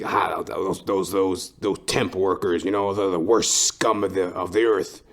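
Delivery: a hint of a sneering manager voice; lightly voiced sneering supervisor; snooty boss. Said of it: "He's like".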